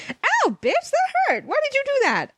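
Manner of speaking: put-on very high girlish yelp; high shrieking girl protest; yelled. A narrator's reading. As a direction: yelp out in pain and outrage. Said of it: "I yelled".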